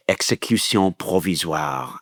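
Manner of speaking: put-on French accent. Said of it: "Your French accent is better".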